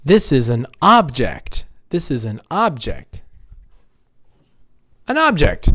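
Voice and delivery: "object" said OBject; first-syllable stress. Shown as a demonstration. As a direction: hit OB in "object". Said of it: "Nouns on the first syllable".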